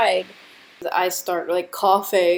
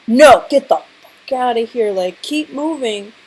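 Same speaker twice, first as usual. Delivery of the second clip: mock cop shout, louder; gruff cop shouting at the Uber driver; cops yelling; 3 semitones higher, 9 dB louder than usual. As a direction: bark it out like a cop waving you off. Cops yelling at her to move.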